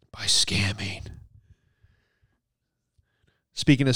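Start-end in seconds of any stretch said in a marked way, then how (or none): 0.1-1.1 whispered aside. Acted. Punchline aside about the locations.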